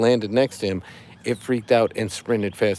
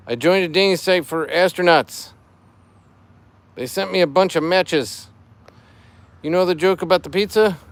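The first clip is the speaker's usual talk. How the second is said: higher, louder cheesy joke delivery; dad joke voice; 6 semitones higher, 4 dB louder than usual. Acted. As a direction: sell the corny pun proudly. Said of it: "I'm doing it in dad joke voice".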